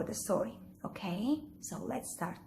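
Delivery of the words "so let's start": ordinary delivery, nothing marked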